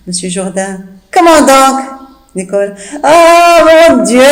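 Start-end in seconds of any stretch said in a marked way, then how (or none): none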